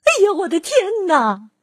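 put-on high startled old lady's cry; old woman startled by the lion, high cry. A narrator's reading. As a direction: gasp it out as if jumped at. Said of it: "瘦小的老妇人喊起来".

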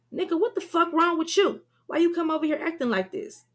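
high, loud, fast angry shout; 1 furious demand. A narrator's reading. Damien confronting Shakim, furious and loud. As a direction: confront him before fighting. Damien shoved, fight starts.

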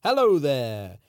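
bright, loud put-on character voice. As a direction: greet them in a character voice. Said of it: "I'm currently in my character voice".

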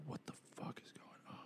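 whisper. Retold or imitated. Confused waking to chaos on plane.

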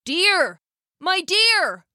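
high-pitched call to his wife; excited husband calling. Acted. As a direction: call out for someone indoors. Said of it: "he called out".

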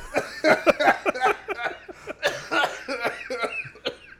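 high, loud wheezing laughter; dying laughing, long and breathless; helpless laughing fit. Real, not acted. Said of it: "Chew's dying over here".

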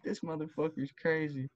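high, soft disbelief; quietly shocked; stunned. Retold or imitated. Own inner reaction.

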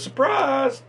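high-pitched singled-out "surprise"; high, excited quoted exclamation; surprise reveal. Retold or imitated. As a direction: imitate the wife springing a gift. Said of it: "she turns around and goes".

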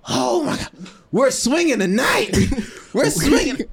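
put-on high, slow, laughing drunken cry; mock drunk squeal, high and drawn out; drunk yelling. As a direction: play the tipsy girlfriend yelling out. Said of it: "she's yelling out".

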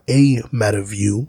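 "a" said as a full "ay", set apart; stress on A. Real, not acted. Honestly answering whether people would live better, stress on "a" as "ay". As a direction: lean on "a", pronounced like the letter A. Correcting "this meta view" to "a.

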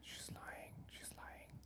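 teasing unvoiced whisper. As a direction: whisper it like a secret.

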